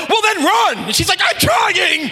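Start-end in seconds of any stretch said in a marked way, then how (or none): 0.1-0.8 high, loud mock dad yell; imitated father's yell. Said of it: "her father yells out". 1.2-2.1 put-on high, yelled kid's protest; mock frustrated wail. Girl yelling back at father.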